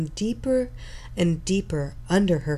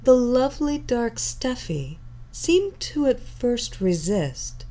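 usual speaking voice

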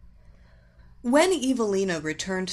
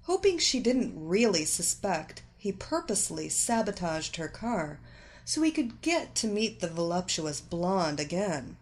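usual speaking voice

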